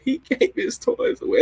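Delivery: high squeaky weepy whine with a laugh; mock crying. Retelling her movie theater crying, high-pitched mock sob. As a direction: squeal it like you're blubbering at a sad movie. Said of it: "what I kept saying when I was crying".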